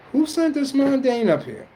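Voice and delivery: high, loud mock indignation; squeaky mock outrage at the mundane; outraged gatekeeper. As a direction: scold the intruder shrilly. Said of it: "They go".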